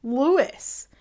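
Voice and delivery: "Lewis" drawn out with a final S; slow LOO-iss, S sounded; American Louis. Shown as a demonstration. Showing how people from the States say it, slow LOO-iss. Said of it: "say Lewis".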